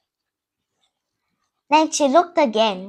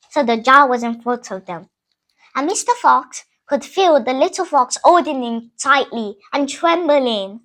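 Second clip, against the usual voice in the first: ordinary delivery, nothing marked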